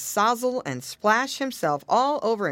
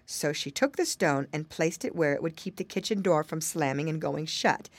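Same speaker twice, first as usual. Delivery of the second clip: plain reading voice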